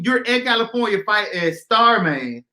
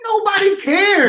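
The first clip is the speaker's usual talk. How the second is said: higher, drawn-out exclamation; exasperated protest; 9 semitones higher, slower than usual. Real, not acted. Arguing over the villain's move to Nebraska, exasperated protest. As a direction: protest it slowly, pitched right up.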